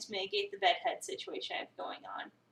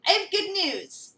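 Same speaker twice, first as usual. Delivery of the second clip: louder, higher call across the house; 6 semitones higher, 5 dB louder than usual. Acted. Owner calling his cat from across the apartment, raised call.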